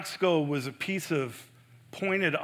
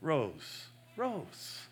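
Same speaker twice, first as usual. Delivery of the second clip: tender; 6 dB quieter than usual. Shown as a demonstration. Pastor showing the tender side of the call, softly. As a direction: call "Rose, Rose" gently, like to a loved one. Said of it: "There's a tenderness about it".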